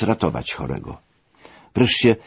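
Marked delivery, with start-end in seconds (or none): none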